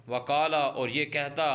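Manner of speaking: normal talking voice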